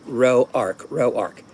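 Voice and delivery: "row ark" spelled out as two words, "row" then "ark"; teaching "row ark" slowly piece by piece; sounding out the name. Shown as a demonstration. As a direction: break "row ark" apart like rowing the ark. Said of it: "how to pronounce my name".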